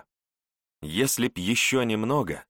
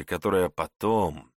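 usual speaking voice

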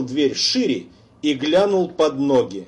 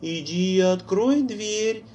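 put-on eerie girl's voice; 6 semitones higher than usual. A narrator's reading. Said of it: "звучал голос Лоры".

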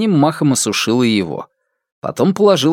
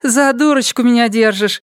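laughing retort; 11 semitones higher than usual. A narrator's reading. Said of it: "засмеялась она".

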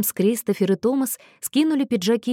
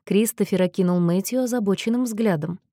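plain reading voice

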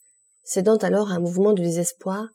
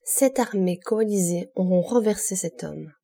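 plain reading voice

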